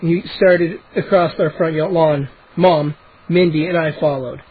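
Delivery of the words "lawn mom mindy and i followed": plain reading voice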